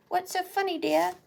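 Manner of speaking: high English mother voice; English mum accent. Retold or imitated. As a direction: playfully mimic an English mum. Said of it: "They're from England".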